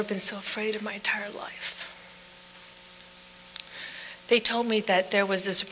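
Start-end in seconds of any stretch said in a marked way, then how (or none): 0.0-1.9 speaking in a voiceless whisper, hushed with fear. Real, not acted. Widow recalling the worst fear of her life, whispered. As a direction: breathe it out, no voice at all.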